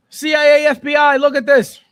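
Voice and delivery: loud, high mock call for the feds; mock alarm call. Streamer jokingly calls the feds on a pirate viewer, loud mock alarm. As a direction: sound the alarm, half joking.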